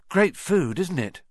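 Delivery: usual speaking voice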